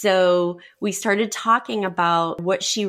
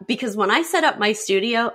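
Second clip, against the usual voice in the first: ordinary talk, nothing marked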